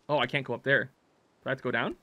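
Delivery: ordinary delivery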